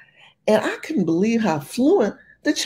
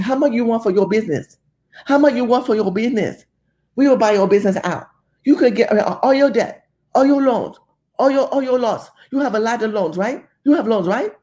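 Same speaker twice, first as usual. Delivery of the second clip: imitating a pushy buyer, higher and fast; pushy salesman pitch, quick and higher; buyer's pitch; 4 semitones higher, faster than usual. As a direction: rattle off the offer like a slick buyer. Chinese buyer voiced in story.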